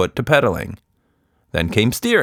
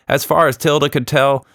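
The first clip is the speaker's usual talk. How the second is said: usual speaking voice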